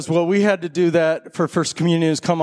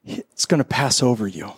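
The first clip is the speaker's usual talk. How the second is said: communion liturgy; 4 semitones lower than usual. Acted. Pastor leading the congregation through communion, solemn and low. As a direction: speak the words of institution with hushed reverence.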